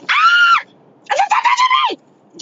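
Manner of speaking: high shrieking yell; retold scream. Screaming at a man who touched her thigh, high shriek. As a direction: shriek it at him. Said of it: "So I was like".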